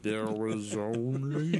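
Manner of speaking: exaggeratedly drawn-out, slow-motion words; mock slow talk. Teasing the listener who said slow down, absurdly slow. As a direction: stretch every word way out in mock compliance. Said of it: "you don't have to talk that fast".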